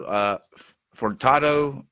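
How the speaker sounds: normal talking voice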